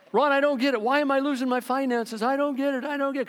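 high, plaintive mock whine; whiny complaint. Churchgoer complaining to Ron about his finances, high, plaintive mock whine. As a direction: play a baffled, self-pitying churchgoer. Congregant whining to pastor.

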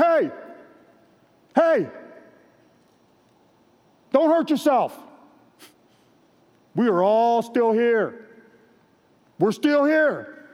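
high, loud urgent shout, drawn out slowly at the end. Retold or imitated. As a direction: call out to stop him, loud and high. Paul shouts to the jailer.

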